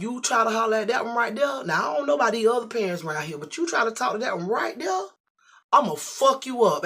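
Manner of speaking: loud, high threat; father threatening the man over his daughter. Retold or imitated. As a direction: warn him off hard.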